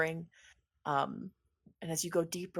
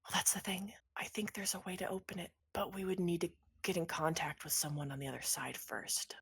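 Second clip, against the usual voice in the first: whisper; 9 dB quieter than usual. Acted. Angel NPC speaks secretly.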